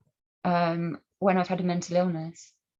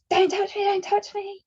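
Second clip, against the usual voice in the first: very high, louder frantic don't touch me; panicked cry; 13 semitones higher, 4 dB louder than usual. Retold or imitated. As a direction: shrink back and cry out in alarm. Man reaching out to touch her.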